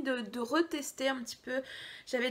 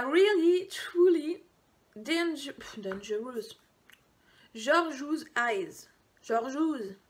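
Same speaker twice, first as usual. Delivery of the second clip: slow, put-on English accent on the name; jokey English accent, drawn out; mock English accent; much slower than usual. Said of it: "Tu as vu mon accent".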